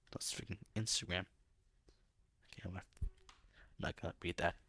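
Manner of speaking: a hushed aside off the read; whispered aside. Real, not acted. Aside about phone buzzing.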